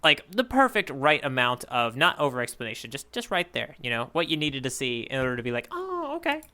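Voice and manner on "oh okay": high, soft "oh, okay" of sudden understanding; a lightly played dawning realization; a little "aha" voice, higher and softer; mock realization. Acted reader reaction quote.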